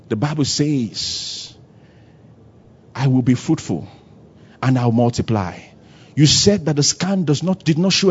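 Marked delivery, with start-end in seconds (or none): 0.1-5.6 low, soft, deliberate scripture quote; hushed, measured confession; quiet declaration. Acted. Models quiet declaration of scripture to doctor.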